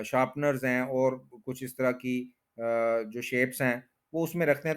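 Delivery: normal talking voice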